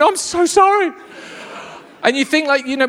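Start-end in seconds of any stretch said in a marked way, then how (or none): 0.0-0.9 high-pitched, hammed-up sheepish sorry; mock apology. Embarrassed customer collapsing with lost confidence, mock apologetic. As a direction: squeak out a crumpled apology. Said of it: "you collapse like a deflated balloon".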